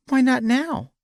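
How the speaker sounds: put-on very high child voice; pleading little girl. A narrator's reading. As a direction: plead like a four-year-old. Small daughter pleads.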